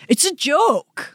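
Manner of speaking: high, slow, dumb "it's a joke" voice; mocking a prankster who says "it's a joke", high and slow. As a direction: play the defensive prankster dumb. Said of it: "you have to go".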